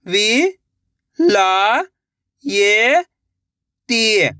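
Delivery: "विलायती" very slowly, syllables held apart; sounding out slowly. Shown as a demonstration. Teacher sounding out a word for young readers. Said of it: "एक अक्षर का उच्चारण करके".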